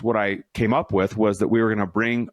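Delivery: ordinary talk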